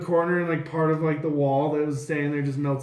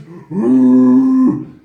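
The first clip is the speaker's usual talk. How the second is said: long loud whooshing blast; big sustained breath-attack sound; dragon breath noise; 7 semitones higher, 13 dB louder than usual. Acted. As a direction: blow out a roaring breath like a dragon. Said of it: "he just breathes".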